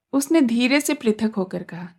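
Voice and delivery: ordinary delivery, nothing marked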